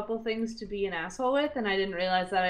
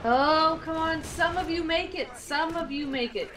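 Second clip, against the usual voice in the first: urging on; 8 semitones higher than usual. Real, not acted. Urging soldiers on.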